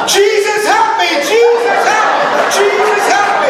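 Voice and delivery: dad in the back of the cart screaming to Jesus; screaming for help. Retold or imitated. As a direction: shriek it like you're plunging down a rollercoaster. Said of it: "screaming at the top of his lungs".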